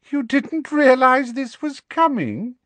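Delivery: high, slow, sugary cruel amusement; callously amused girlish voice. Acted. Umbridge mocking the sacked teacher, high girlish and callously amused. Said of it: "said a high, girlish voice, sounding callously amused".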